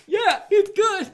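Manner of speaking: high, loud, slow mock voice; drunk crowd member. Roasted audience member loving the insults, hammed-up high voice. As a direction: ham up a dopey happy heckler.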